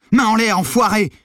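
high, very loud yell; furious shouted order. A narrator's reading. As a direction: yell it like holding someone at gunpoint. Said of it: "cria Maddy". (cri furieux)

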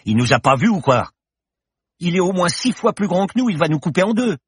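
high, loud panicked cry. A narrator's reading. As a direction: voice climbing in terror. Yacht charging at their boat.

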